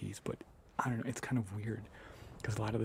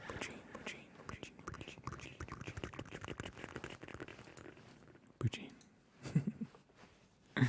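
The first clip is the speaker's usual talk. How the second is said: "poutine" whispered over and over; ASMR trigger word; whispered repetition; 2 semitones higher, 6 dB quieter than usual. Real, not acted. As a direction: chant "poutine" under the breath.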